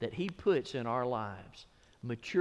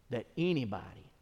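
ordinary delivery

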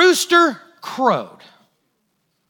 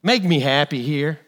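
mock whiny plea; 10 semitones lower, 4 dB quieter than usual. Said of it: "and go".

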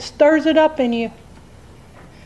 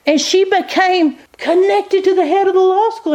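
lightly voiced lawyer telling the shocking part; lawyer confiding; 5 semitones higher than usual. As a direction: reveal the scandal bit by bit. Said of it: "She goes".

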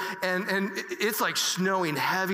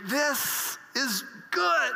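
savoring; 7 semitones higher, much slower than usual. Retold or imitated. Savoring the moment while fishing.

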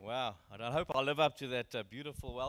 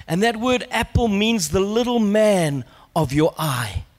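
very loud, slow, emphatic proclamation; booming declaration with weighty pauses; full-force preaching; 3 semitones higher, 14 dB louder, slower than usual. Real, not acted. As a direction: drive each phrase home to the whole church.

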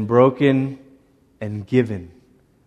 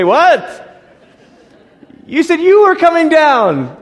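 shocked protest; 12 semitones higher, 6 dB louder, slower than usual. Acted. Moses protests God.

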